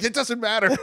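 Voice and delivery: mock shrug; imitating him. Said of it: "He's like".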